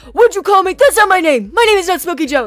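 high, very loud outraged yell in imitation; retold yelling. Homeless man objecting to his nickname, imitated yelling. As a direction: yell it like an offended, angry man. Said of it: "he starts, like, yelling at me".

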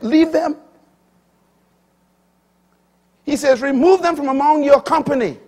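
high, loud commanding repeat; preacher booming the apostle's order; forceful preaching shout. Acted. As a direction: thunder Paul's command at the congregation.

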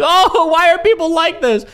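high, slow, whiny mock of a streamer. Streamer mocking a rival's fake-innocent whine. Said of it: "she's like".

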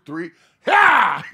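loud grunting heave on ha; heave shout. Retold or imitated. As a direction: shout the pull like movers. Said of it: "We were like".

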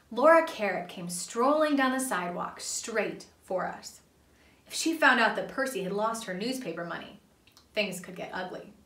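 ordinary delivery, nothing marked

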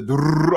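trilled drum-roll imitation; rolled drum noise. Host mimicking Japanese drums from a new video, rolled drum noise. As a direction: roll out a drum sound with the tongue.

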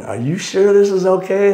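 anxious passenger asking; mild mock worry; nervous question. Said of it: "he's going".

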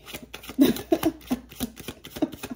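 can't stop laughing, breath only; real giggle fit; helpless breathy laughter. Real, not acted. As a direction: silent wheezing laugh. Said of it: "I can't help it".

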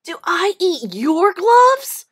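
put-on boy calmly arguing with a bully; reasonable. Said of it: "John asked reasonably".

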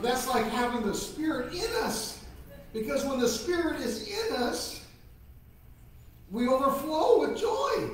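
put-on bright high children's sermon voice; sweet patient sunday school. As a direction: lift it up and cheer the kids.

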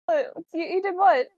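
high, loud incredulous questioning; alarmed disbelief. Acted. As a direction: demand to know, aghast. Alarmed reaction to Destroyer's act.

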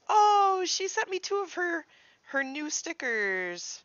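high, loud thrilled gush; excited over the stickers. Real, not acted.

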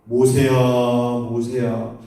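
low, drawn-out call; lightly voiced God calling out to someone; God calling Moses. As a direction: call the name slowly, deep in the voice. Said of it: "부르셨어요".

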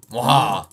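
loud cackling villain laugh; evil villain laugh. Pretend. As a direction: gloat with a big evil laugh. Killing cows with new sword.

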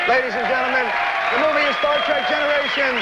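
bright showbiz sign-off to the studio audience; show host sign-off. Acted. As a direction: announce the break like a TV host. Host announces guest and break.

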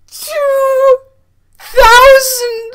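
high, crying screams of disbelief; overwhelmed, tearful shouting; screaming with joy. Real, not acted. Repeats the price in shock.

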